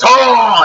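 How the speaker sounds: the pumped-up bouncer bursting out; mock roar. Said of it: "And he says".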